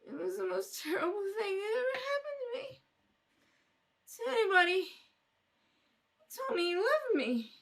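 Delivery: a jilted woman crying over broken promises; tearful self-pity. Acted. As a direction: whimper it out, feeling sorry for herself. Tearful drunk tells her story.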